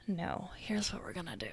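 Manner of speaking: menacing whisper; sinister whisper. Acted. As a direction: whisper it like a scheming villain. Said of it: "that voice".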